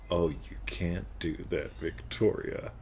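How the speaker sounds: slow, soft seductive whisper. Shown as a demonstration. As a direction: whisper it slowly, teasing her. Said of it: "you can change, like".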